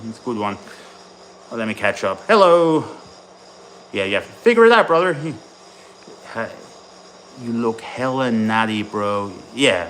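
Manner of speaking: ordinary talk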